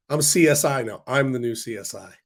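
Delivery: usual speaking voice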